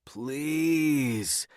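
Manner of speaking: high, drawn-out begging; pleading. A narrator's reading. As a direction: stretch it out and wheedle. Begging partner to ditch work.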